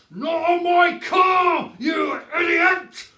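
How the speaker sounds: bellowing. A narrator's reading. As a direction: roar at the fool near his car. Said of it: "Mister Hazel bellowed".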